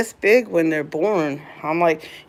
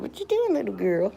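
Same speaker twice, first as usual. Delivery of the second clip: high cooing question; 4 semitones higher, 5 dB quieter than usual. Real, not acted. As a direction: coo at the pet. High pet talk.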